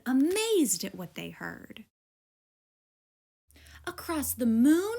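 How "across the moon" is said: raised, louder disbelieving cry; astonished cow crying out; surprised cry. A narrator's reading. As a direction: gasp out the question in disbelief. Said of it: "cried Buttercup".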